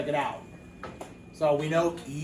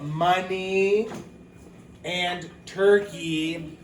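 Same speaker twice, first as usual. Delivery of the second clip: money and turkey stretched out very slowly; slow model words; 2 semitones higher, much slower than usual. Shown as a demonstration. Teacher reads example words to the class, very slow. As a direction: linger on each word for young readers. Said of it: "teacher models example words".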